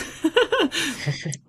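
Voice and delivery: high soft real laugh; a quick giggle; giggling afterward; short laugh. Real, not acted. Laughs again.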